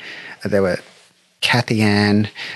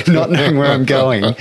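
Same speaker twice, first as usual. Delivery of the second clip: laughing; 6 semitones higher than usual. Real, not acted. Laughing at bumpy van ride.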